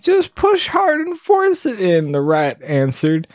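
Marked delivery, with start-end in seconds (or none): 0.0-2.1 hammed-up squeaky rodent king; shrill rat king. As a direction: screech at the rat like an outraged king. Rats trading lines.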